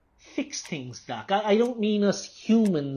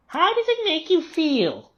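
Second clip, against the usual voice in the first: mocking mimicry; 11 semitones higher than usual. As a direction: sing the doctor's question back much higher. Mocking the doctor's question.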